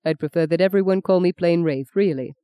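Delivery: ordinary delivery, nothing marked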